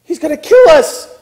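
loud, high-pitched terrified outburst; panicked cry. Acted. Husband to wife after seeing the angel, panicked cry. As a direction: cry out in mock terror. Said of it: "Manoah cries out".

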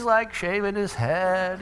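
ordinary talk, nothing marked

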